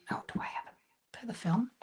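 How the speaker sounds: whispered, half to yourself; muttered question to the screen; hushed to herself. Real, not acted. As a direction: quietly check whether it works. Checking the camera to herself.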